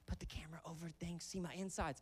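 hushed unvoiced whisper; whispering wife. Wife whispers to husband during C-section, hushed. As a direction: whisper it behind the curtain. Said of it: "And Shauna's like".

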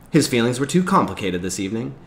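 usual speaking voice

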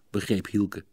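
ordinary delivery, nothing marked

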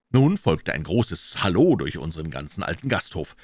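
usual speaking voice

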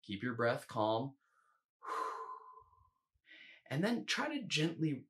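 ordinary talk, nothing marked

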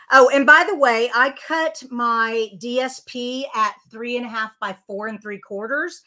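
usual speaking voice

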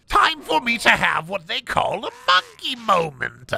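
high, manic announcement with a laugh; Joker voice. Acted. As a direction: cackle into it like a gleeful villain. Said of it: "the Joker".

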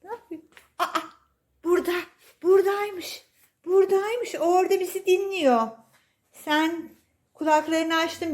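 high, cooing pet-talk voice; baby talk to cat. Acted. Owner cooing to her cat, high baby talk. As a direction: gush over the cat like a baby.

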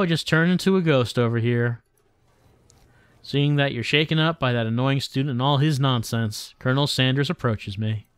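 ordinary talk

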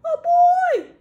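very high, very loud alarmed yell; startled bear's drawn-out shout. Acted. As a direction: bellow high in storybook panic. Yelled the bear.

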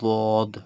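বদ with a heavy, emphatic ظ-like sound. Shown as a demonstration. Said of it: "ভারী হচ্ছে না আওয়াজটা".

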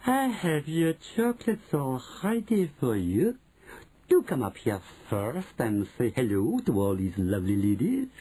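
cooing, dripping with syrup; syrupy Grand High Witch. A narrator's reading. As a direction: coax the child with gentle false sweetness. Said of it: "soft and gentle and absolutely dripping with syrup".

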